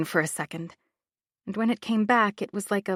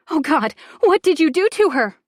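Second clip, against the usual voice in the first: high, loud sobbing demand; crying accusation; 8 semitones higher, 8 dB louder than usual. Acted. Laura crying at Bronwyn over Charlie. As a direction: cry it at her, desperate. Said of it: "Stop crying".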